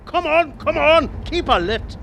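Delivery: very high, loud urging; thrilled cheering. Acted. Passenger cheers the cabbie through the chase, high and loud. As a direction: cheer the car round the corner.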